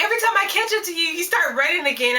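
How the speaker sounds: high, rushed mock grumble; exasperated sister. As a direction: rattle it off fast and high like the annoyed sister. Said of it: "And so she's like".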